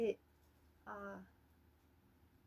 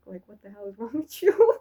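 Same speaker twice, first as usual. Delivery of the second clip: mock scold; 16 dB louder than usual. Mock scolding her past self.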